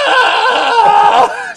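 very high, loud shriek of horror; hammed-up scream of fright; mock scream. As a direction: shriek like you just saw a body in the water. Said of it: "his wife's gonna go".

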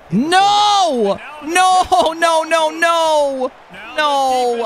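high loud repeated no; anguished no. Real, not acted. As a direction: cry out as the play goes wrong. Disaster play in the game.

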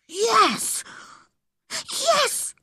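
put-on very high, breathless-eager boy; excited whisper. A narrator's reading. Boy promises to keep a secret, excited whisper. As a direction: squeal it under your breath. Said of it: "he whispered excitedly".

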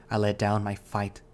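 ordinary delivery, nothing marked

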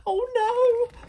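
very high, loud little-wolf wail; terrified wail. A narrator's reading. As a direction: cry out in panic, trapped. Trapped wolf hears giant coming.

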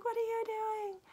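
very high, strained imitation of a distressed woman; acted-out high cry of protest; distressed outcry. Woman seeing all her hats being burned, distressed outcry. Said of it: "and she's like".

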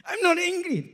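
high, loud mock-angry protest; hammed-up heated denial. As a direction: insist you're calm in an angry tone. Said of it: "Tone of voice, huh?".

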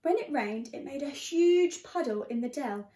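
ordinary delivery, nothing marked

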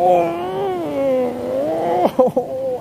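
high burst of laughter at the sting; startled high laugh; pained laugh. Real, not acted. Pained laugh after fish hits feet.

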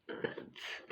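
soft whisper. Real, not acted. Whispered aside.

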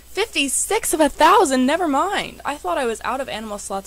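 high, loud burst of disbelief; loud astonishment. Real, not acted. Player discovering a huge slot count, loud astonished outburst. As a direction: exclaim it, voice shooting up.

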